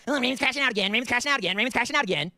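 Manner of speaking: mocking chat voice. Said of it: "everybody's gonna clip this".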